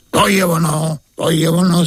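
light joyful exclaim; delighted king. Acted. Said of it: "zaradoval se král".

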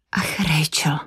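a sudden breathless whisper of dismay; blurted whisper. Acted. Katy whispers to Rachel in front of the detective, blurted whisper. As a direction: hush it out in alarm.

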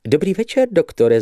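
high, chirping greeting; twittering lady. A narrator's reading. Lady greets the doctor, twittering. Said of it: "zašveholila dekoltáž".

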